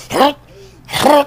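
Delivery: two short mock gags; hammed-up dry heave; imitated retch. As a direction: mimic a friend starting to heave. Imitates friend retching at doorbell.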